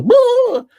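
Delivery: sudden high loud startled cry; startled yelp. Retold or imitated. Someone startled by a horn, shrill yelp. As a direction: jump at a horn going off. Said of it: "they go".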